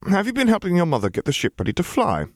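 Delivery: high-pitched question to a little one; talking to a child. A narrator's reading. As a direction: pitch it well up, as to a small child. Man chats to a small boy.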